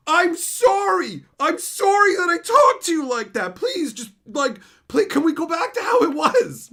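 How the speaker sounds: high, loud, drawn-out whiny begging; mock pleading apology. Coworker begging to make up, mock pleading.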